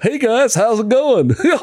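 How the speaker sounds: high, cheery Goofy-style voice. Retold or imitated. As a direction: play it like a goofy cartoon dog on the radio. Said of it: "would always talk like Goofy".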